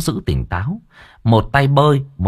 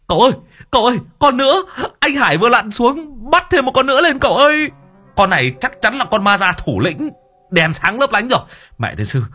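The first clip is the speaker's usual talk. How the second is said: very high, fast excited shriek; shrieking excitedly; 12 semitones higher than usual. Acted. Servant calling the master about another ghost, high shriek. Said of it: "nó rít lên".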